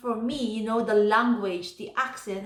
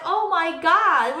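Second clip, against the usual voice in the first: mock exasperation; 6 semitones higher, 5 dB louder, slower than usual. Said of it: "laughing at me".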